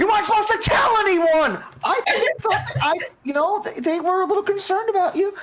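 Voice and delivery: high-pitched soldier protesting his secret was told; embarrassed soldier outburst. Acted. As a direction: blurt it out high and mortified.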